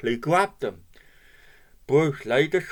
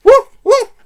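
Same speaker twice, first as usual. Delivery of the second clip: very high, loud yaps; barking dog; 17 dB louder than usual. A narrator's reading. A dog barks from nearby, high loud woofs. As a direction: bark out two sharp woofs. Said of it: "barked a voice nearby".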